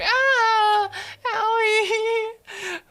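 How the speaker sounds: high whiny cry of pain; fake crying. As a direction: wail like a hurt baby.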